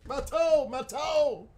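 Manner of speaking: high, loud yelling in pain. Real, not acted. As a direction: cry out over a stubbed toe. Stubbed toe, yelling.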